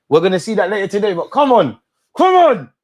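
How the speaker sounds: rising to a loud, high yell; hyped shout. Real, not acted. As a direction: pump up the team. Hyped watching the match.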